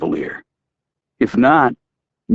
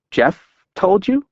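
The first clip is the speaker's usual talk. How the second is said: tearful; 10 semitones higher, much slower than usual. Acted. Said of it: "Alice made a hiccuping sound".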